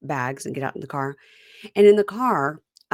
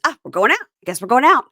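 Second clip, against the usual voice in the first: high, loud, rising call; hammed-up calling out; mock announcement; 7 semitones higher, 8 dB louder than usual. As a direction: sing out the decision, lifting at each end. Acting out Stacy deciding to go.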